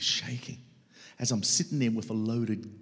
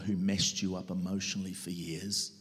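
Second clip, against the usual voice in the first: normal talking voice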